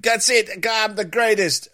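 high, cocky triumphant boast; cocky self-praise. Retold or imitated. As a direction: crow about your own brilliance. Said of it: "you think".

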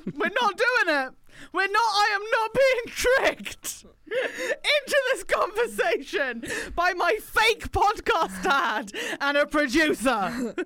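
high, slow, laughing through tears; half-laughing, half-crying refusal; laughing protest. Real, not acted. Laughing-crying protest at being tricked.

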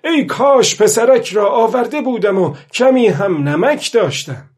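high, loud wishing; aloud, loudly. A narrator's reading. Old man alone wishing the boy were here, loud call. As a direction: cry the wish out above the narration. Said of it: "پیرمرد با صدای بلند گفت".

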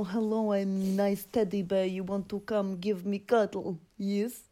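put-on slow, low, soft Russian accent; low Russian voice making a cuddly offer. Co-host playing a cuddly Russian for contrast, slow Russian accent.

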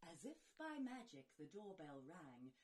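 ordinary delivery, nothing marked

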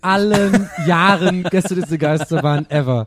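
a laugh breaking the words, slowing at the end; laughing relief. Real, not acted. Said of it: "das war das Unangenehmste".

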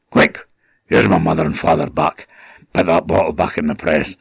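rough, raspy little changeling voice; urgent rasped order from the cradle; changeling baby. A narrator's reading. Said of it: "suddenly the baby goes".

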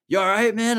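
a cop checks on a man on his knees; cop asking. Retold or imitated. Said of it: "And they're like".

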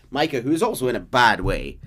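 usual speaking voice

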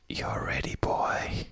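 whispery put-on woman's voice; hushed, badly faked sultry woman; female voice. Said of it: "meant to be like a female's voice".

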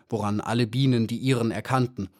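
plain reading voice

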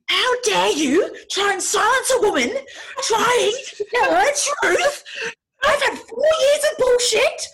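high, loud mock-indignant shriek, drawn out; angry outburst. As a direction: re-enact a furious tirade at full pitch. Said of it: "I was so angry".

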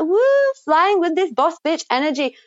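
high whooping hype; sudden high woo then hyped line; excited whoop. Retold or imitated. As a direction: cheer it out like a boss. Hyping up friend's confident energy.

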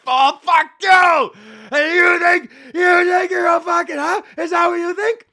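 mock yelling match. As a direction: scream it like a street argument, hammed up. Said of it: "people yelling at each other".

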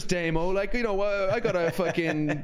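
playing the stingy club owner for laughs; mock boss rant. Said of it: "I was like".

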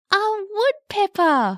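slow, drawn-out punchline. Acted. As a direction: savour the punchline. Punchline of the joke.

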